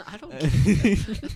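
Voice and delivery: laughing through the words; can't stop laughing while speaking. Real, not acted. Laughing reaction.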